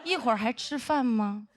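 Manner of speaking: imitating a slow, drawn-out sarcastic greeting; passive-aggressive. Senior colleague meets the former crew member, drawn-out sarcasm. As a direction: stretch the words with snide mock deference. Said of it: "非常阴阳说".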